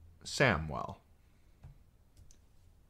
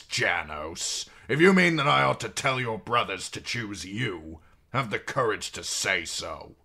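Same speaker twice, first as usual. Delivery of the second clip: cold stern king; 4 semitones higher, 6 dB louder than usual. Acted. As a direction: challenge him icily. King rebukes Janos.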